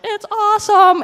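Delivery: high, loud mock gush; mock-enthusiastic burst. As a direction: sell it as pure glory. Mock glowing version of outreach.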